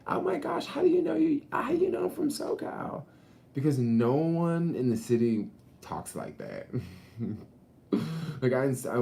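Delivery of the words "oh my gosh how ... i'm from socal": very high excited falsetto; mock a gushing SoCal girl; squealing oh my gosh; high-pitched SoCal girl. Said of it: "dude, yo, high pitch".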